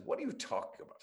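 put-on a sketchy English accent done as Eric Idle; an apologetic attempt at an English accent. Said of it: "I shouldn't do the accent".

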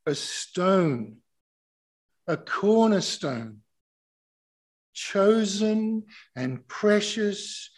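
slow, loud scripture proclamation with pauses; slow reading. Real, not acted. Preacher reading scripture to the congregation, slow and forceful. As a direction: declare it phrase by phrase, stretching key words. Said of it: "gonna read this really slowly".

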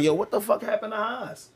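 high-pitched mock disbelief; imitated baffled friend. Acts out friends' baffled reaction.

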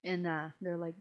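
usual speaking voice

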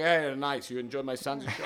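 lightly imitating an Italian immigrant dad's accent; a hint of an Italian-born father's accent, proud; off the boat Italian father. Comedian imitating his Italian immigrant father greeting fans, hint of accent. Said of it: "That's how he talks".

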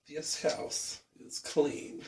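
very slow, hushed and whispery; eerie soft whisper, put on; movie psychic impression. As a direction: draw each word out softly, almost all breath. Echoes quote as the film's psychic.

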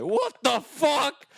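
mock startled then soothing. Friend imagines the stranger's hands, high and slow.

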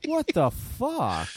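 slow shocked curse; stunned. Real, not acted. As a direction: drag it out in disbelief. Shocked at eighteen-battery mod.

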